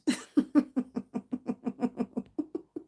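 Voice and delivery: long drawn-out chuckle; real amused laughter. Real, not acted. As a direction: laugh at your own joke. Laughs at her own joke.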